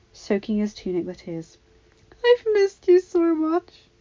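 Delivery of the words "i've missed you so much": much higher, slow tearful plea; sobbing. A narrator's reading. Padme tells her husband she missed him, sobbing. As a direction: sob it into his chest. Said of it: "she sobbed".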